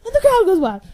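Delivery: loud, hammed-up announcer call; crowd goes wild. Reader joking like an announcer, loud and hammed-up. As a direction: bellow it like a stadium announcer.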